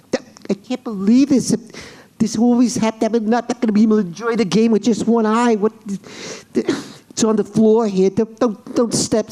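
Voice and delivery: high-pitched, flustered complaining; frantic sketch character. Acted. Sketch player groping on the floor for a contact lens, flustered. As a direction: play someone in a mild panic over a loss. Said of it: "here's the what happens".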